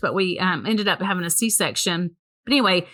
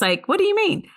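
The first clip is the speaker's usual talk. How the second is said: puzzled; 11 semitones higher than usual. Retold or imitated. Puzzled reply to the news, retold.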